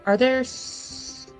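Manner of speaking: ordinary talk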